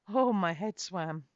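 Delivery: ordinary delivery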